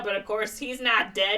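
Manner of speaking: ordinary delivery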